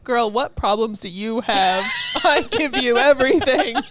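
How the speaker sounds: hammed-up frustrated yell, laughing; mock exasperated. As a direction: owner scolding her cat, laughing loud mock rant. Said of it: "I'm like".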